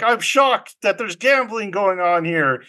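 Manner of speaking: hammed-up, high-pitched astonishment; feigned shock. Captain feigns shock in retold scene.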